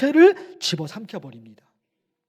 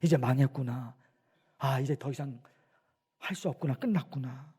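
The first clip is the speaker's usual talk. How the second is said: soft, low, defeated murmur; acted discouragement; 7 semitones lower, 12 dB quieter than usual. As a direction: act out someone with no hope left. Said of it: "낙심이 되잖아요".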